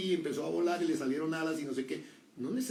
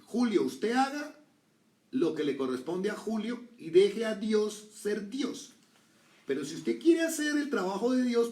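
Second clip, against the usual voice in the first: quoted advice; 8 semitones higher, 6 dB louder than usual. Retold or imitated. Quoting advice he heard.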